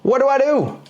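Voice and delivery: put-on a small boy begging his granny for help; whiny little boy. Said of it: "And he goes".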